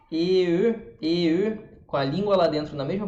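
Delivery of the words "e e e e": E gliding from "ê" to rounded "eu"; E closing to rounded. Shown as a demonstration. Teacher showing lip change for learners, E to rounded E. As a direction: keep the tongue still, close lips on E. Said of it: "fechando a boca pro E".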